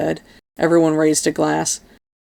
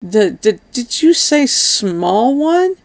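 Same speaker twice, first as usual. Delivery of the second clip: stammer, then stretch the question upward; stuttered, drawn-out question climbing high; stunned stammer rising into a long question; stammering disbelief; 6 semitones higher, much slower than usual. Acted. Stammered shocked question about spider.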